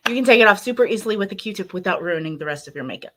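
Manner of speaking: fast restated line; rushed. Real, not acted. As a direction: hurry to finish the point. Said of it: "I'm distracting myself".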